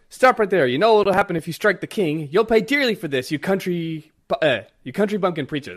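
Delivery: loud, high furious threat; angry threat. Retold or imitated. Guard threatening the preacher Kuma, loud and furious. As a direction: spit it at him.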